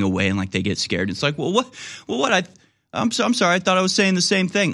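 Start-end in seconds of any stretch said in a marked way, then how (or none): 1.4-4.7 much higher, pleading, confused; backpedal, baffled and sorry; flustered apology. Retold or imitated. Speaker pleading with startled friends at a party, high flustered apology.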